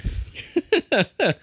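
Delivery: high-pitched laugh. Real, not acted. Podcaster amused by own jab at the movie, laughing. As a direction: burst into high, light laughter.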